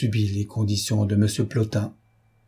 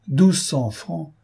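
high-pitched indignant protest, building louder; affronted great man objecting; indignant exclamation; 6 semitones higher, 3 dB louder than usual. A narrator's reading. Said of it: "S'écria le grand homme d'un air indigné".

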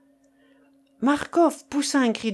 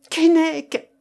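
loud, high-pitched call; crying out; 7 semitones higher, 7 dB louder than usual. A narrator's reading. Marcof calls Keinec on seeing inside the coffin, crying out. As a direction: cry out his name in shock. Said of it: "s'écria-t-il".

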